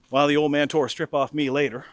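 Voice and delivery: ordinary delivery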